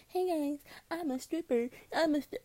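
high-pitched sing-out of a confession; mock announcement. Stripper imagines confessing to the parents, mock announcement. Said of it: "I'm not gonna come out like that".

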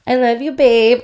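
calling out to someone nearby. Real, not acted. Calls out to boyfriend.

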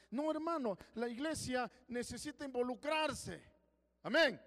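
rally the church to act; fervent preaching. Acted. Preacher urging the congregation, fervent and loud.

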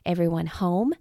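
ordinary delivery

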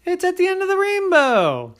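high leprechaun voice with a laugh; giggly leprechaun. Acted. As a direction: giggle it out like a cheeky little leprechaun. Liam character voice, laugh.

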